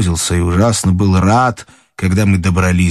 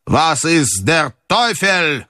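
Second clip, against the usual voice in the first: slow, higher German exclamation; mild cry of indignation in German, drawn out; сердитый окрик; 7 semitones higher, much slower than usual. Acted. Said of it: "крикнул он". (медленный возмущённый возглас по-немецки)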